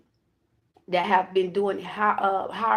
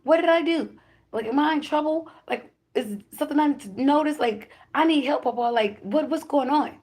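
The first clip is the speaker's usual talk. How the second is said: much higher, eager and puzzled questions; excited questions to Pawpaw; 7 semitones higher than usual. Retold or imitated. To her grandfather's spirit in the corner, excited high questions. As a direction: ask it all at once, thrilled and confused. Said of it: "I said".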